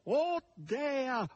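high, howling wail. A narrator's reading. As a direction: howl it like a desperate pig. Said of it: "ululò questi".

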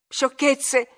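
high, exasperated cry; acted angry exclamation; beside herself. A narrator's reading. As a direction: throw it out furiously. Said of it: "gridò Catherine fuori di sé".

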